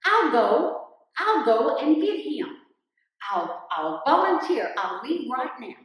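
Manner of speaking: loud, eager offer to go; eager volunteer. Acted. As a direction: jump in loudly, keen to be chosen. Rabbit eagerly volunteers in story.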